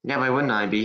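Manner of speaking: ordinary talk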